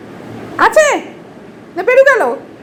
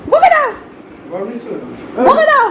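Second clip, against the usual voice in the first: excited urging; 5 semitones higher than usual. Real, not acted. Owner urging the dog to scold the man, high excited egging on.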